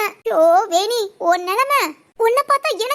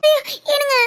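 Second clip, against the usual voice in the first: higher, whimpering fake wail; overacted crying; 4 semitones higher than usual. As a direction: ham up the tears for sympathy. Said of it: "என்னடி over act பண்ற".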